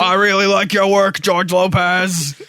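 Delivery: loud, drawn-out grumpy fanboy praise; angry nerd voice. Acted. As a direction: growl the compliment like a cranky superfan. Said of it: "I'm an angry nerd".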